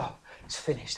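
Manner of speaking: "Oh, it's finished" breathed out low and soft, dying away; feeble last gasp. Shown as a demonstration. Preacher showing the wrong reading of Jesus' words, weak gasp. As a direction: sigh it out like a defeated final gasp. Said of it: "and it was a sort of last gasp".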